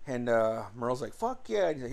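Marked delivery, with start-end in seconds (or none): 1.1-1.7 excited cheer. Retold or imitated. Brother cheers at beer.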